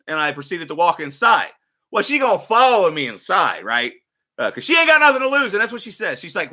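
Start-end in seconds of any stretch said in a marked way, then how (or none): none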